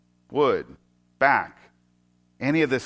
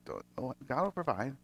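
softer, gentle reassurance; reassuring murmur; 4 semitones lower, 14 dB quieter than usual. Retold or imitated. Father reassuring his son, soft and gentle. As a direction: soothe the boy with it. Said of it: "trying to reassure Isaac".

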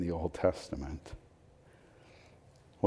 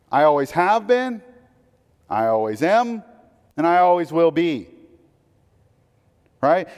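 higher, louder proclaiming of the angels' praise; fervent preaching; 10 semitones higher, 6 dB louder than usual. Acted. Pastor declaring the angels' song to congregation, soaring preaching. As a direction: proclaim holy, holy, holy like a herald.

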